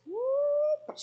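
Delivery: loud, high swooping whoop. Shown as a demonstration. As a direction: trace a curve with a whooped noise. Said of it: "Your curve is going like this".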